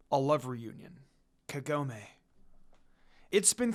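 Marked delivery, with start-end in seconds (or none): none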